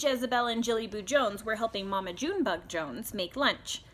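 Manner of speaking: usual speaking voice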